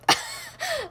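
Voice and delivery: sudden squealing laugh; high shriek of laughter. Real, not acted. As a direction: burst into shrill laughter. Bursts out at reply.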